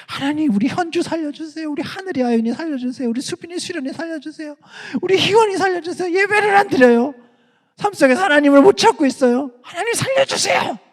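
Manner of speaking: a pastor crying out for his youth, begging; desperate pastor's prayer. Retold or imitated. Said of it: "이렇게 기도해야 되는 거예요". (목사님의 간절한 울부짖는 기도)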